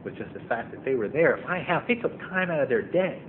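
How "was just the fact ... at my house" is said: ordinary delivery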